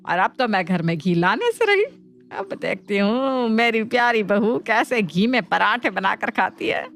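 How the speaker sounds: plain reading voice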